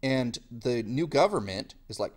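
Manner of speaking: ordinary delivery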